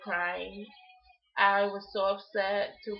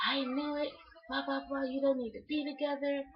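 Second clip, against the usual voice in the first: imitating a dismissive relative, higher pitched; mock him with a raised, knowing voice; 6 semitones higher than usual. Retelling a relative who saw the Facebook photos, high mocking voice. Relative mocked in retold story.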